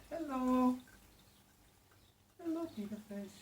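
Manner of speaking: put-on high sing-song baby voice for the fish; pet talk. Owner greeting her new fish in high, sing-song pet talk.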